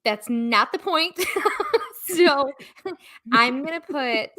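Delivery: ordinary delivery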